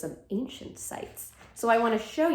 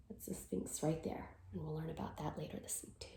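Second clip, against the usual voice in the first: soft, fast whispered aside; hushed whispered aside; 5 semitones lower, 18 dB quieter than usual. Real, not acted. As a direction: let them in on a secret.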